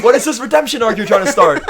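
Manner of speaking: high, loud playful protest; mock outrage. Co-host objecting to the redemption arc, mock outrage. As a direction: demand it in hammed-up disbelief, laughing.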